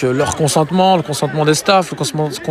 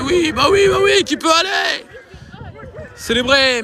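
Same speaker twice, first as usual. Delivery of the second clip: excited sports commentary; 13 semitones higher than usual. Acted. Celebrating the goal.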